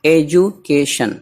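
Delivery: Education slowly, in separated parts; syllable by syllable. Shown as a demonstration. Teacher showing a vowel-rich word, education syllable by syllable. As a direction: break education into slow chunks with small gaps.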